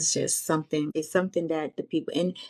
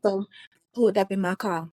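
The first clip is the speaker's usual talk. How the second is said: ordinary delivery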